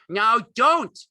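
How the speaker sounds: loud, high angry command. A narrator's reading. Lenny orders the struggling woman, angry. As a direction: shout it angrily. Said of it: "Then Lenny grew angry".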